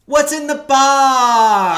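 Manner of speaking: loud, high, drawn-out wail; anguished movie quote. Acted. Host gives cohost a film hint, loud hammed-up wail. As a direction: cry it out in hammed-up agony.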